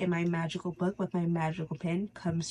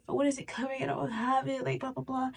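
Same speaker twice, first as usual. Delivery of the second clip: mock impatient whine, pitched higher; impatient whining; 7 semitones higher, 4 dB quieter than usual. Mocking someone stuck waiting, in an impatient whine. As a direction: whine like it's never coming. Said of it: "you're just like".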